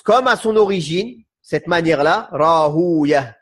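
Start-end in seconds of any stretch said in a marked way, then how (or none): 2.3-3.4 Rahuya with long oo, ending -ya; Persian pronunciation. Shown as a demonstration. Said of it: "Ça, c'est la prononciation perse".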